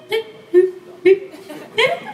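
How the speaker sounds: short, high beeps; high electronic pings, spaced apart; imitated message beeps. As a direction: mimic chat alerts with your voice. Imitates instant-message alert sounds.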